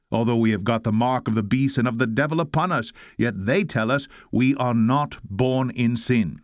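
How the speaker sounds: usual speaking voice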